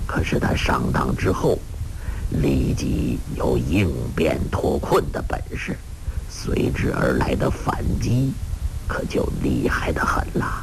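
put-on very low, hushed old-master voice; story character's voice. Character warning listeners about the enemy's counterattack, low grave voice. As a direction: speak far down in pitch, measured.

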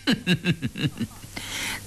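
soft, drawn-out evil laugh; sinister chuckle. A narrator's reading. Commander dismisses a plea for villagers, chuckling sinisterly. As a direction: chuckle darkly under the breath. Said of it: "阴笑了一下".

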